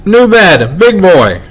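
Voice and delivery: put-on high-pitched, childlike boast; pleased-with-himself small kid; proud little boy. A narrator's reading. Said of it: "Was he proud".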